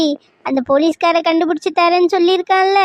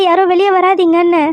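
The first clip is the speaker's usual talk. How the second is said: usual speaking voice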